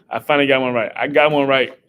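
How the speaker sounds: loud, triumphant; a loud happy I got one right; celebrating. Real, not acted. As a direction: crow about finally getting it.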